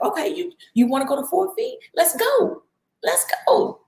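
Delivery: put-on rally a hesitant child, building excitement; eager encouragement. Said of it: "God is like my husband".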